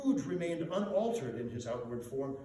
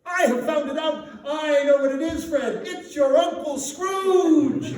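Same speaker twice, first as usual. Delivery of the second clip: much higher and louder, bursting out the answer; a guest shouts the answer to Fred at the party game; triumphant shout; 11 semitones higher, 13 dB louder than usual. A narrator's reading. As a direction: yell it like you've won the game. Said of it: "someone cried out".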